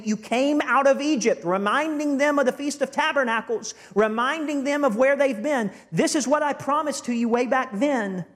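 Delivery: high, ringing prophetic reading; declaimed scripture. Acted. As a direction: proclaim God's promise to the people.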